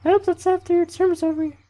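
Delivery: loud, squeaky falsetto camp leader giving a speech; hammed-up mock female falsetto; bad girl voice. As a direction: lecture in a badly faked, very high female voice. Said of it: "That was a really bad girl voice".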